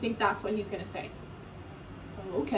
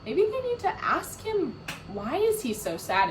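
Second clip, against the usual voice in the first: ordinary delivery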